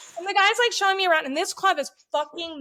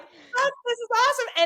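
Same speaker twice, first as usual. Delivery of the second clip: excited; 9 semitones higher, 4 dB louder than usual. Retold or imitated. Excited realization in story.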